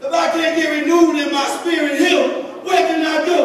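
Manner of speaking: rising preaching. Acted. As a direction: build it up and call out to the church. Climbing preaching.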